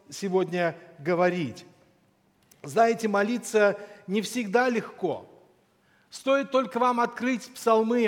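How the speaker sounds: ordinary talk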